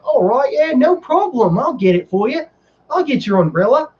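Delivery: high-pitched Australian lilt; Australian accent. Retold or imitated. Ride attendant promising to fetch an umbrella, mimicked Australian accent. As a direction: put on a chirpy, obliging Australian accent. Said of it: "she was Australian".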